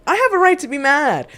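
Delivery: forceful declaration. Real, not acted. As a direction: hit it hard and high. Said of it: "repeated emphatic line".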